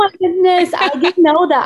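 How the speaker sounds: high-pitched delighted astonishment; real surprise. Real, not acted. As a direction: react amazed to unexpected news. Learns host's husband is Palestinian.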